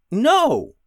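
loud, high sharp no; quick denial. Acted. Beatrice quickly contradicts Punch, loud and high. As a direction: cut Punch off fast.

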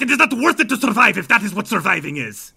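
high, loud shouted denial; furious refusal. Acted. Ivan angrily refuses Stir fry's advice, shouting. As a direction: shout him down in anger. Vampire argues with Stir fry.

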